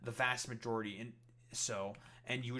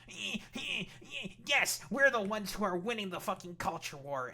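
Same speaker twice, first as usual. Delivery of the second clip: much higher, louder mock Redditor voice; high whiny parody of a Reddit loser; mocking neckbeard; 9 semitones higher, 7 dB louder than usual. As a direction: sneer it like a smug neckbeard. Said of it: "Redditor neckbeard fucking loser being like".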